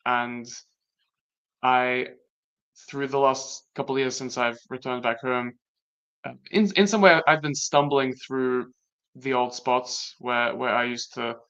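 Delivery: ordinary delivery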